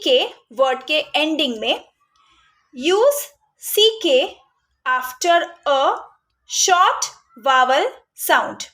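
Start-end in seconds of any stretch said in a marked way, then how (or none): 2.9-8.6 put-on very slow, every word spelled out for the children; slow dictated rule. Shown as a demonstration. Teacher to parents, reading the spelling rule very slowly. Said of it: "तो short vowel sound".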